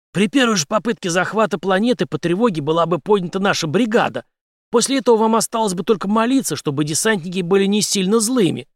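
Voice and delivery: high, emphatic warning; confident commander. A narrator's reading. Henry warning the major about the brigade, confident. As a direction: drive the warning home.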